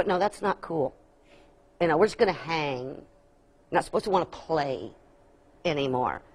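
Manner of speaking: put-on low, quiet, laid-back older kid; too cool. Older kids refusing to play on the slide, coolly dismissive. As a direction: play it cool, dropping pitch and volume. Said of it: "older ones looking cool".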